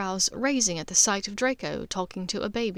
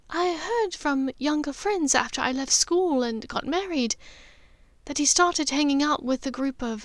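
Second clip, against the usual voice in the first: higher motherly voice, kindly teasing her son; Narcissa, gentle mother; 5 semitones higher than usual. Acted. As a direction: play the caring mother checking on her son.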